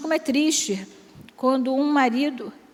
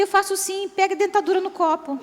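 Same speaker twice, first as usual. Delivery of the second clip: much higher mock old man voice, cracking into a laugh; old husband; 8 semitones higher than usual. Old husband answers his wife's wish, higher and laughing. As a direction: deliver the punchline as a chuckling old codger.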